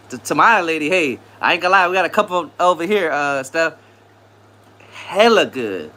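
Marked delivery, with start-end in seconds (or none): 4.9-5.9 drawn-out savoring. Pretend. Savoring tamales.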